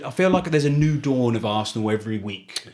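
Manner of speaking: ordinary delivery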